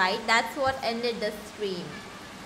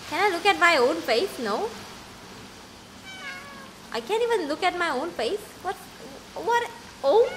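ordinary talk

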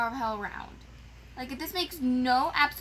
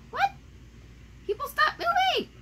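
very high exasperated plea; exasperated squeal. Real, not acted. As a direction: squeal it at the other players. Annoyed at other players.

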